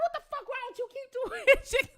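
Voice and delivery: high mock whispered-ish mama scold; mama cussing her during service. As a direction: imitate mama's hushed scolding at the funeral. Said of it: "She was like".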